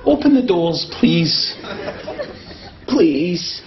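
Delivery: low, polite asking; meek asking. Acted. Giving in to the lift, meek and polite. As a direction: ask the lift nicely, defeated.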